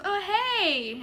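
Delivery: high, mock-sweet greeting; sickeningly sweet. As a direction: imitate a fake-nice hello. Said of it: "she's acting sickeningly sweet".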